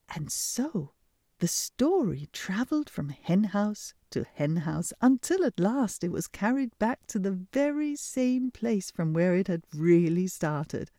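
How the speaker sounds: ordinary delivery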